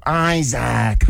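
high drawn-out announcer Isaac; mock arena announcer; announcer call. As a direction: call the name like a stadium announcer. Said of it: "the announcer would go".

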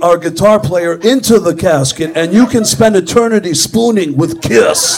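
loud mock pitch, laughing; heckling Gene. Comic heckles Gene mid-interview, loud and laughing. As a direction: shout the joke over him. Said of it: "kept jumping in the middle of his interview going".

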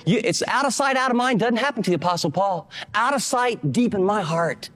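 normal talking voice

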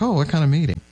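put-on a light old-lady lilt asking; elderly aunt voice.

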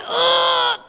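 high, sustained effort groan; straining grunt. Acted. Doctor pulling hard on forceps, straining grunt. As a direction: heave with a high-pitched grunt.

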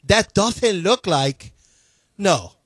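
slow, mocking Castilian accent; exaggerated Castilian-accented English. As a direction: stretch it out in a lisping Castilian accent. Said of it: "in your Castilian accent".

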